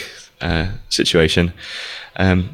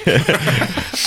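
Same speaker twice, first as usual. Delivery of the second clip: short amused laugh; a quick real laugh; 8 semitones higher than usual. Real, not acted. Laugh after reading comment.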